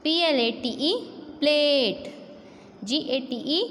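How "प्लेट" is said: प्लेट with the "ay" held long; प्लेट, long drawn-out A; long vowel. Shown as a demonstration. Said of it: "ए का sound थोड़ा लंबा होता है".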